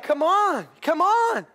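loud, high, drawn-out appeal; exasperated urging. Real, not acted. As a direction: goad them in disbelief. Exasperated at church's lack of enthusiasm.

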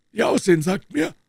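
a hint of a composed doctor; the doctor explaining why he came in, lightly voiced. A narrator's reading. Doctor speaking in the story.

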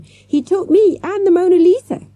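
put-on high, loud child's outburst; shouting. A narrator's reading. Hostage girl telling manager of the theft, shouting. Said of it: "Jessica shouted at him".

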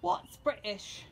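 slow, odd fake British; made-up British accent. Mom playing dumb for the family, made-up British accent. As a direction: stretch it into an invented British accent. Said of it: "I think this is her own made-up accent".

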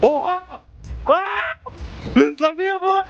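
high surprised shouts; startled. Real, not acted. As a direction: gasp as it speeds up. Ride speeds up, exclaims.

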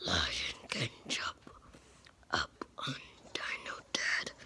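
hushed low creature voice; pretend lion voice. Plays the lion figure.

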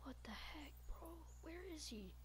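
hushed scared whisper. Real, not acted. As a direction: breathe it out nervously. Hiding, whispering in fear.

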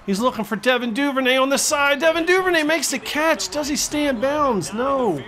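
high, rising excited catch call; excited play-by-play. Real, not acted. Commentator calls Duvernay's sideline catch, excited. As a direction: call the catch with a pitch jump.

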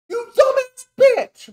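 very high, slow, screamed insult; shrieking insult at a rival streamer; furious scream. Real, not acted. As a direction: scream it out in real fury.

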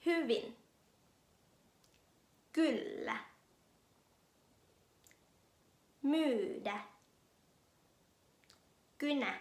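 Hyvin, Kyllä, Myydä, Kynä and Syksy with a rounded whistle-like y; Finnish y. Shown as a demonstration. Teacher models Finnish y words for students to repeat. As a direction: model each word slowly. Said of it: "ลองมาฝึกคำศัพท์กันเลยนะคะ".

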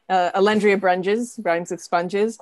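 ordinary talk, nothing marked

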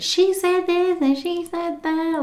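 high mocking drunk rant. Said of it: "of course I'm talking shit".

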